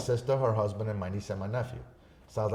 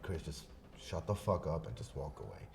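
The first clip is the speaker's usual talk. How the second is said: low, soft talk to himself; self-restraint; 6 semitones lower, 9 dB quieter than usual. Retold or imitated. Man telling himself to walk away, low and restrained. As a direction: keep it down, reining yourself in.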